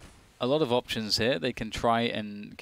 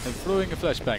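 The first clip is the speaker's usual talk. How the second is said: higher-pitched, badly faked Jamaican accent; 5 semitones higher than usual. Caster clowning for his co-caster mid-match, in mock Jamaican accent. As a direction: put on a clumsy Jamaican accent. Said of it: "you cannot do a Jamaican accent".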